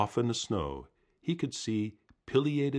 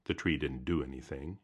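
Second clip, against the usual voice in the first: ordinary delivery, nothing marked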